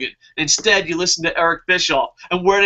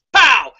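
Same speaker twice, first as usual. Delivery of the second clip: shouted cartoon "Pow!", louder and higher; mock punch sound; 5 semitones higher, 6 dB louder than usual. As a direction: blast out the magic fist noise. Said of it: "and goes".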